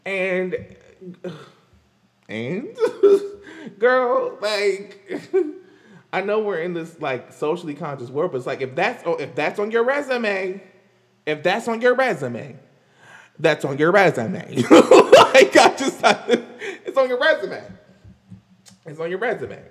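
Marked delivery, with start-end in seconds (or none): 2.7-3.7 high, loud burst of laughter; a sudden loud laugh that takes over. Real, not acted. Cracking up at own joke. 14.7-16.4 loud, high laughter through the words; can barely get the words out for laughing; real laughter spilling over the words; laughing hard. Real, not acted. Cracking up at own joke. 16.9-17.6 a loud punchline laughed out; tossing off the punchline mid-laugh. Real, not acted.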